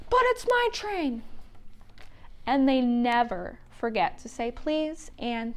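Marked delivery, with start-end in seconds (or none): 0.1-1.2 high, protesting little voice; whiny penguin. Acted. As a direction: whine that it's yours.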